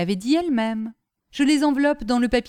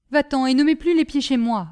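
curt dismissal; 5 semitones higher, 5 dB louder than usual. Acted. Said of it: "répondit madame Delmy".

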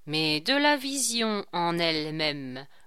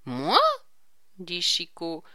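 usual speaking voice

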